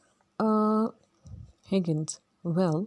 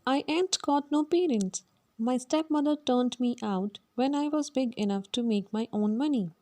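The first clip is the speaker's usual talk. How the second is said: put-on higher Cockney girl's voice, matter-of-fact; Eliza; 5 semitones higher than usual. Eliza tells Higgins she has no parents, in a higher voice. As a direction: pitch it up and state it bluntly.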